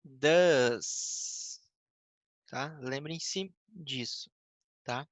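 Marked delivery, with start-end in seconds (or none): 0.0-1.6 "does" drawn out long with an uh vowel; "does" held and falling, teacher's model; correct DOES. Shown as a demonstration. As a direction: model "does" slowly as one long duhz. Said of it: "é does".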